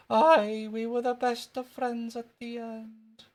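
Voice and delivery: put-on very high, drawn-out Scottish boy; squeaky Scottish schoolboy, stretching it out; Jimmy Krankie. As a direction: insist in a slow Scottish squeak. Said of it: "That was wee Jimmy Cranky".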